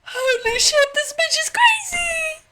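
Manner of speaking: very high, squealed reaction; high-pitched freaked-out cry; shocked outburst. Real, not acted. Alarmed reaction to the wailing princess.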